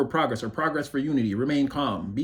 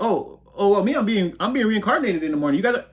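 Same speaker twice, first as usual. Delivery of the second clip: high, fast, teasing show-off voice; hammed-up smug goodbye, pitched up; smug mock farewell; 7 semitones higher, 4 dB louder, faster than usual. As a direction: gloat playfully about leaving them behind. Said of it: "It's like".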